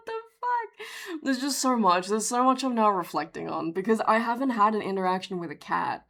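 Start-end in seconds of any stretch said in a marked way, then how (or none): 0.1-0.9 tiny, very high voice of disbelief; high-pitched, helpless bafflement; bewildered squeak. Real, not acted. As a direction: squeak it out, stunned. Stunned after telling the story.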